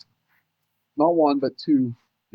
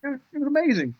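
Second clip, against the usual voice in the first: high-pitched, thrilled wonder; real amazement; 9 semitones higher than usual. Real, not acted. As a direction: marvel at it, pitched well up. Marvels at the hunt, has goosebumps.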